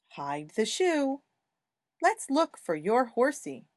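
gentle sing-song word reading; reading to a toddler. Acted. Reads vocabulary aloud to toddler.

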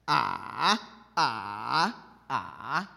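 "ah" dipping down, then swinging back up; three exaggerated dipping "ah"s; monster-voice third tone. Shown as a demonstration. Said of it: "I call it the monster voice".

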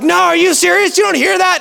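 high, disbelieving neighbor voice; incredulous neighbor. Retold or imitated. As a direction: play the neighbor who can't believe you.

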